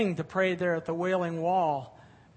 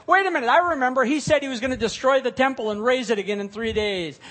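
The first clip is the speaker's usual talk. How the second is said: louder, higher and fast; eager accuser; 5 semitones higher, 8 dB louder, faster than usual. Acted. Accuser at the trial remembering Jesus' words, excited and fast. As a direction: blurt it out like a sudden discovery.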